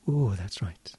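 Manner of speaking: soft whispered ooh and affirmation. Acted. Hypnotist soothing the listener, whispered. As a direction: sigh it out in a whisper.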